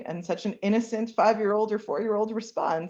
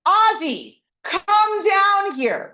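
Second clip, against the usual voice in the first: higher, louder, slow call by name; mad parent yell; 11 semitones higher, 8 dB louder, much slower than usual. Retold or imitated. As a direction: summon the child, annoyed. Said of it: "You call that child by name".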